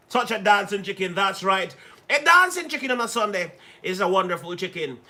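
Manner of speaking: ordinary talk, nothing marked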